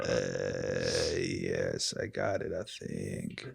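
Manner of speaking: morning voice. Pretend. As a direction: drop the voice way down like first thing in the morning. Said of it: "Morning voice".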